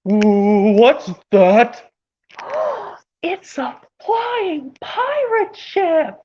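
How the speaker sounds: gasp, then cry out slowly in awe; amazed cartoon character. Cartoon character spotting a flying pirate ship, amazed. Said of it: "how about this impression where it goes".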